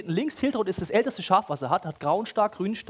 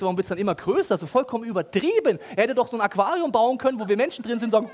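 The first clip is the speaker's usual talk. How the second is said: ordinary talk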